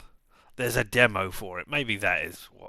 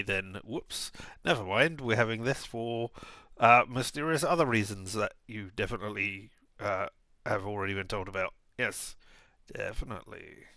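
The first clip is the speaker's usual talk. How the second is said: ordinary talk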